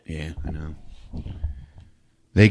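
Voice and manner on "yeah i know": low, hushed agreement; very soft confiding aside; whispered reply. Real, not acted. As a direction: whisper back softly. Storyteller answers the aside.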